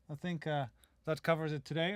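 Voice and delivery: usual speaking voice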